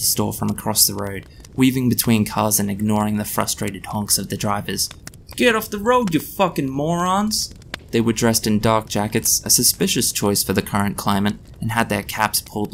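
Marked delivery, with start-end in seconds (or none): 5.4-7.5 angry yell. A narrator's reading. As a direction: bellow at the men blocking traffic. Passerby yelling at jaywalkers.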